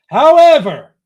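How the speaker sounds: loud, high mock-official however; emphatic however. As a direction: boom out the word however. Reading embargo notice, mock emphasis.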